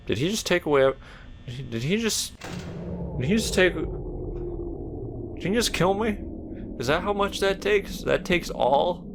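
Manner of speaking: can't believe the game just killed you; disbelief. Real, not acted. Shocked at sudden death in game.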